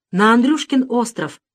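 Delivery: put-on a boy suddenly taking charge; resolute. A narrator's reading. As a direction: announce the plan with no room for doubt. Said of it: "решительно сказал Саша".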